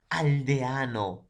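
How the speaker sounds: "Aldeano" slowed, with E and A split: al-de-a-no; teacher dictates "Aldeano" syllable by syllable; syllabified demo. Shown as a demonstration. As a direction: draw out "Aldeano" showing the vowel break. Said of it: "va a ser aldeano".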